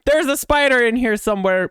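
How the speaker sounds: high, loud panicky blurt; scared. Real, not acted. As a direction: blurt it out in real fear. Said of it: "spider scare".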